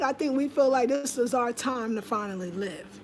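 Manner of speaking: normal talking voice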